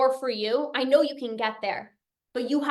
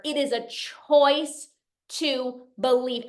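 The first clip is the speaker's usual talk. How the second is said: slow emphasis; slower than usual. Real, not acted.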